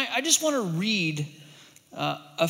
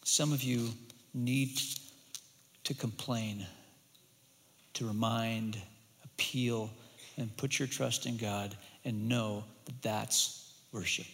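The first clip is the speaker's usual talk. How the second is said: soft, lower closing words; hushed preaching; 7 semitones lower, 7 dB quieter than usual. Acted. Preacher closing the lament teaching quietly and softly. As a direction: draw them in gently.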